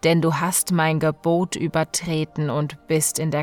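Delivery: usual speaking voice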